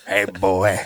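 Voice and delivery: low Southern drawl. Shown as a demonstration. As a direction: put on a low-pitched Southern twang. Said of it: "I just wanna hear that Southern drawl".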